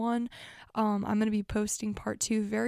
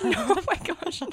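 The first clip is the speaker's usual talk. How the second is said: high, loud burst through laughter; laughing; 9 semitones higher, 5 dB louder than usual. Real, not acted. Teased as a psychopath by her friend, laughing hard. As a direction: crack up while protesting.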